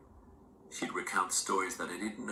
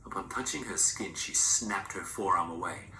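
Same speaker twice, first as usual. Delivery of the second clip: plain reading voice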